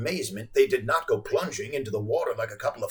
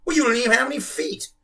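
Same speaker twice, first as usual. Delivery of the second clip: fast, high scoffing retort; 11 semitones higher, 4 dB louder, faster than usual. A narrator's reading. Girl mocking the footless whale, scoffing. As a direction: toss it off with scorn. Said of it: "Samantha scoffed".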